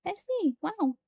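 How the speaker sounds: high-pitched pretend admiration; mock-discovery voice, high and gushing; imagined impressed reaction. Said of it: "Because I'd be like".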